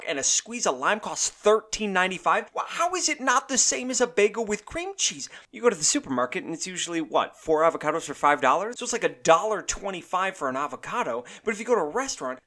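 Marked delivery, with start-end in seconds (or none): none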